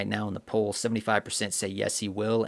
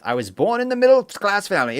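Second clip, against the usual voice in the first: higher, louder mock politician line; mocking Kamala; 8 semitones higher, 6 dB louder than usual. Streamer mocks the candidate's go-to line, in a higher, louder voice. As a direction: parrot her stock line, a bit hammed-up. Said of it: "dodging questions every time with".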